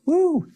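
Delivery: high-pitched celebratory woo; high cheer. Pretend. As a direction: whoop up high with joy. Cheering after a sunny answer.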